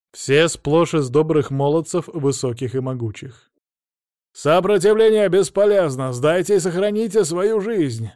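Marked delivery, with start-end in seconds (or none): 4.3-8.1 high, loud commander's yell; straining shout. Acted. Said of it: "Надрывал голос командир взвода". (надрывный крик командира)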